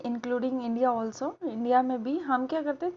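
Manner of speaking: ordinary talk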